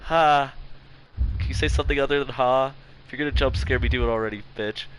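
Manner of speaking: loud tense waiting; scared horror game streamer. Real, not acted. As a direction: brace for the jump scare.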